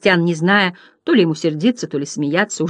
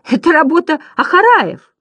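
high, louder protest; Bastian shouting; 10 semitones higher, 5 dB louder than usual. A narrator's reading. Boy defending the tower, high indignant cry. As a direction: raise the voice in high-pitched objection. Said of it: "кричал Бастиан, борясь со смехом".

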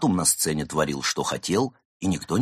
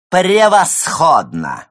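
loud, slow, high triumphant cry; exclaiming; 10 semitones higher, 8 dB louder, slower than usual. Acted. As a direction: crow it out in triumph. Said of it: "воскликнул Заяц".

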